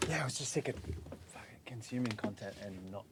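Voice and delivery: hushed off-mic whisper. Real, not acted. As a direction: murmur it under your breath. Quiet pre-show aside before recording.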